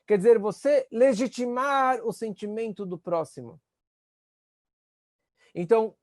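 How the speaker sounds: ordinary talk, nothing marked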